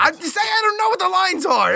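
high, defensive protest. Acted. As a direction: protest your innocence a bit too much.

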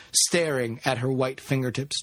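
plain reading voice

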